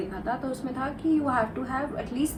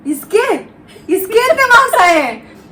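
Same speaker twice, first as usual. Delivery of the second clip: much higher and louder, stunned; shocked disbelief; 10 semitones higher, 12 dB louder than usual. Retold or imitated. Mom's friends mocking astonishment at her marks. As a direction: gasp it out in disbelief.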